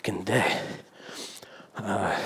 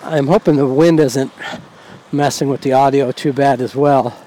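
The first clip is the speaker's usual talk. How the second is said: raised voice; 3 semitones higher, 5 dB louder than usual. Real, not acted. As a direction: speak up to be heard over wind and traffic. Talking over traffic and wind.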